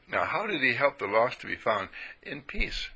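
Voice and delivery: normal talking voice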